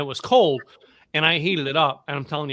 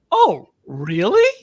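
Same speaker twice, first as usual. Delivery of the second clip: drawn-out, louder mock amazement with a laugh; surprised oh really; 3 semitones higher, 10 dB louder, much slower than usual. Retelling the deli lady's reaction, slow laughing surprise. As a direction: play the clerk, slowly wowed.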